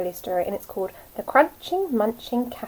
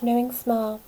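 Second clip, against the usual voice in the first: plain reading voice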